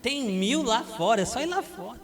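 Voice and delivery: put-on high, loud scoffing at a teen's heartbreak; mocking parent. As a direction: brush off the crying with a laugh, dismissive.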